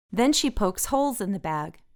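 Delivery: plain reading voice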